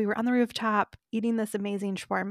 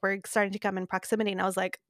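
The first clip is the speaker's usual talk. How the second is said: ordinary delivery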